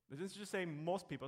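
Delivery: ordinary delivery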